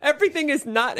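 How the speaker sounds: high-pitched mock melodrama; hammed-up, high protest; imitating hysterical mother. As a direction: parody an overacting movie mother. Mimics mother from played clip.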